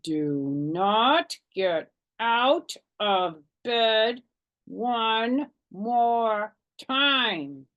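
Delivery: very slow, through closed teeth, menacing; scary through teeth. A narrator's reading. Mother warns her daughter to stay in bed, slow and menacing. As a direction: spell out each word like a stern warning. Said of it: "talked very scary with her teeth closed".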